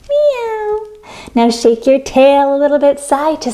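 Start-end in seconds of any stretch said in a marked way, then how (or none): 0.1-0.9 high, soft kitten meow; tiny mewing cat. Shown as a demonstration. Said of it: "and cat".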